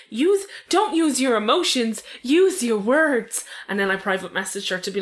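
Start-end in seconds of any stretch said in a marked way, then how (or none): 0.1-3.4 slow, condescending mock; mocking the patronizing commenter; patronizing mimic. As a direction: drawl it like a smug scold. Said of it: "She was like".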